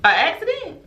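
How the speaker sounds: high, incredulous echo; scornful disbelief. Retold or imitated. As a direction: repeat the excuse as if it were absurd. Scoffing at the student's excuse.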